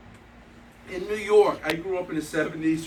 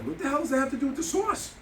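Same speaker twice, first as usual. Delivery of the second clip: high-pitched, incredulous retort; exasperated disbelief; 8 semitones higher than usual. Retold or imitated. Diner challenging the owner's excuse about sauce, exasperated disbelief. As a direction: throw the excuse back in mock outrage.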